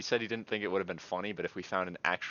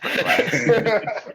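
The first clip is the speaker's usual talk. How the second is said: laughing; 12 dB louder than usual. Real, not acted. Laughing mid-story.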